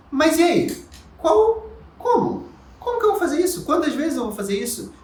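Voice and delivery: high-pitched, impatient pupil asking how; imagined student's questions. Acted. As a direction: pipe up as a student full of questions. Voicing the eager student's questions.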